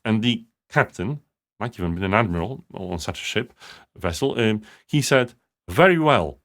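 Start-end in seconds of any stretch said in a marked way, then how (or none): 5.7-6.4 a crisp American Navy captain's "Very well", a bit higher and louder; American Navy accent. Retold or imitated. Captain approving the helmsman's sharp turn, in a mock American Navy accent. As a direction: imitate an American naval captain answering "Very well". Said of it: "in his American, um, proper, uh, Navy accent".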